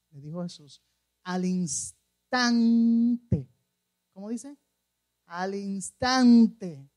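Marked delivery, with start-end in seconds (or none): 1.2-3.5 "instante" stretched very long and loud; emphatic drawn-out stress. Real, not acted. Preacher marvels at the immediate healing, "instante" hugely stretched. 5.2-6.9 "instante" loud and stretched; emphatic drawn-out stress. Real, not acted. Preacher repeats it for the congregation, "instante" drawn out loudly.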